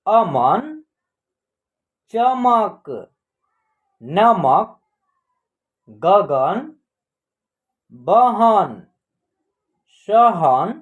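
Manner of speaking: deliberate, isolated model word; word-list reading. Shown as a demonstration. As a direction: read it out for learners to repeat.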